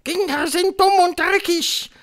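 very high, loud proclamation; thundering Grand High Witch. A narrator's reading. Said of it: "donnerte die Hoch". (donnernde Hexe, hoch und laut)

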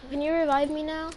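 ordinary talk, nothing marked

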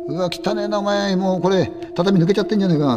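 high, grossed-out remark; disgusted friend. Acted. Disgusted at ruined room.

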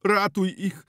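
high, loud desperate cry for help; desperate plea. Acted. Zenon begging the healer for rescue, desperate high cry. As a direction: beg him to save them, high and loud.

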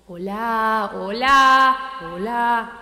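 loud call into the mic; mic test call. Real, not acted. Testing the new mic, calling out loudly.

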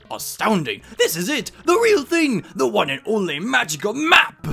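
rising, loud amazement; thrilled professor. Acted. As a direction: build to a marvelling cry. Professor beholds the real map.

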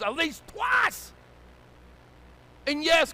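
high, loud sermon shout; full-force preaching. Real, not acted. Pastor to ungrateful congregation, full-force preaching. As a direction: preach it hard, punching key words.

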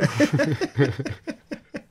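loud, high laugh; a real laugh at his choo choo joke. Real, not acted. Cracking up at the model railway joke.

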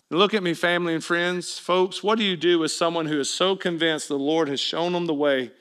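usual speaking voice

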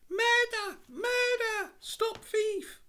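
piercing, very high shriek for help; loud falsetto cry of alarm; thin sharp scream. A narrator's reading. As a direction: scream it shrilly into the street. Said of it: "sharp voice screamed from the street".

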